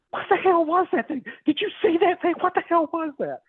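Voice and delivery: extremely high squealed imitation of a freaked-out partner; mock panicked shriek. Partner spotting the creature in the pasture, mock shriek. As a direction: mimic her alarm in a very high voice.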